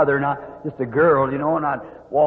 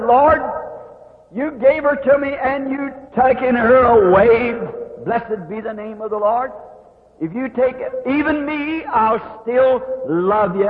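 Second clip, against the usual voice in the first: high, raised, slow prayer of surrender; 6 semitones higher, slower than usual. Retold or imitated. To God as his baby dies, a high raised surrender. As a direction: cry it out, then let go. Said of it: "And then I said this".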